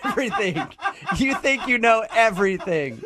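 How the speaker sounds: put-on high-pitched whiny teen parody; mock arguing daughter. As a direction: imitate a daughter protesting to her dad. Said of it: "a daughter and her dad argue".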